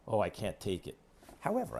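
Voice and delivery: ordinary talk, nothing marked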